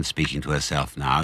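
ordinary delivery

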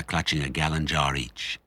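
ordinary delivery, nothing marked

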